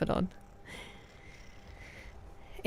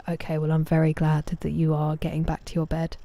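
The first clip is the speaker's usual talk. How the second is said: ordinary talk, nothing marked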